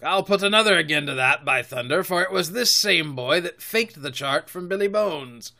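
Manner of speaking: heated pirate captain's accusation; Silver, accusing pirate. Acted. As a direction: swear it like an old sea dog.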